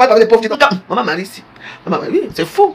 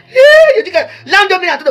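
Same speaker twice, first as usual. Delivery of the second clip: laughing shout; 8 semitones higher, 4 dB louder than usual. Real, not acted. Laughing outburst in heated rant.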